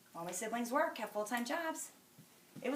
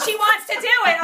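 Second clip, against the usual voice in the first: higher and louder, pushing hard; louder, higher mock quote of Sherry's insistence; excited insistence; 10 semitones higher, 15 dB louder than usual. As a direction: call it out like you're cheering her on. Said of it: "Sherry's like".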